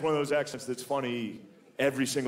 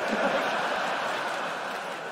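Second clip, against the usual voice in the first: high breathless laugh; 13 semitones higher than usual. Retold or imitated. Announcer's startled reaction.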